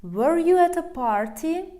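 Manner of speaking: loud, high question rising at "party"; question intonation. Shown as a demonstration. Teacher showing English question word order, loud rising question. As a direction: push "Were you" up high and loud, end rising. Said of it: "we change the word order".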